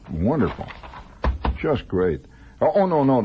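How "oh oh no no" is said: mock alarm. Real, not acted. Catches own mistake.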